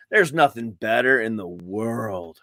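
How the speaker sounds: ordinary talk, nothing marked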